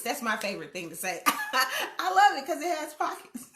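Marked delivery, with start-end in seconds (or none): 1.3-3.5 high, loud, laughing gush; playful delight. Acted. Woman loving her dress pockets, playful delight. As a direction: gush happily, pitched way up.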